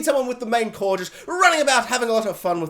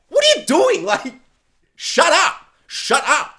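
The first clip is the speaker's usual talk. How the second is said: high, loud mock scolding; exasperated outburst; 9 semitones higher, 5 dB louder, slower than usual. Reviewer imagining someone stopping the accent, exasperated. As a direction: cut her off in loud disbelief.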